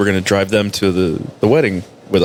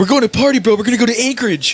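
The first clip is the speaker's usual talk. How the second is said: mock party bro; 12 semitones higher, faster than usual. Mocking soldiers heading to Anchorage.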